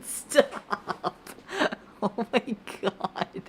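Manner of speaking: high, unvoiced laughing stop, then slow; wheezing with laughter; breathless laughing. Real, not acted. Streamer laughing at her own kidney stone joke, breathless.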